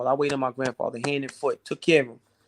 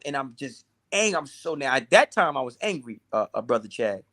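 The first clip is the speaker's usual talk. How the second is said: normal talking voice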